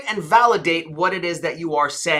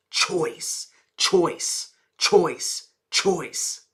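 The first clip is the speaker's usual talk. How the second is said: CHOICE soft and falling, each set apart; demonstrating tonality; 5 dB quieter, much slower than usual. Shown as a demonstration. Coach shows the tonality of the last word, soft falling CHOICE. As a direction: repeat CHOICE in a hushed downward glide. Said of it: "You see the tonality?".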